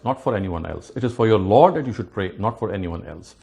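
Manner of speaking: normal talking voice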